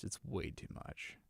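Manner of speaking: whispered complaint under breath; whispering. Real, not acted. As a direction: drop to a whisper. Complaining about editing cost.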